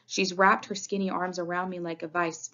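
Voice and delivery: plain reading voice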